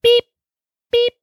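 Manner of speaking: flat robotic beeps ending in a high, loud boop. Pretend. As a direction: beep like a machine. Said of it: "just start doing your beep".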